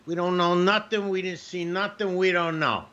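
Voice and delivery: mock Italian uncle playing dumb; Italian family voice. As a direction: play the Italian relative who knows nothing. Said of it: "My Italian family".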